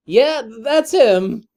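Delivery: awkward lawyer voice. Acted. [name] voicing Phoenix.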